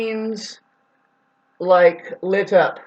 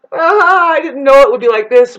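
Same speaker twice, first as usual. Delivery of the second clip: mock disappointment; 6 semitones higher, 8 dB louder than usual. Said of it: "they're like".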